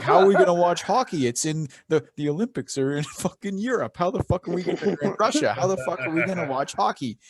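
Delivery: loud, high, frantic disbelief; exasperated panic. Retold or imitated. Friends retelling the Olympics in Europe, frantic outcry. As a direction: wail it like the game is lost.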